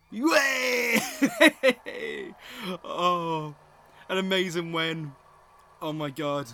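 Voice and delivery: loud, high delighted cheering with a laugh; real joyful cheer. Real, not acted. Vlogger cheering as Ke Huy Quan wins, loud joyful shout. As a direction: yell with joy at the win.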